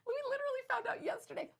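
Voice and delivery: very high, fast, loud excitement; real squealing excitement; giddy excitement. Real, not acted. Giddy over brand-new secret news.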